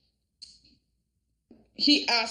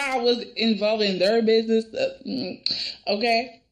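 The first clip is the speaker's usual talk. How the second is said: usual speaking voice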